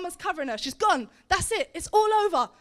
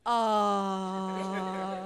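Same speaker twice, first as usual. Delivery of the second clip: long, low, sustained "aah"; drawn-out aah; 8 semitones lower, 4 dB quieter than usual. Acted. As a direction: hold a low, sighing aah. Group reaction to lonely shepherd.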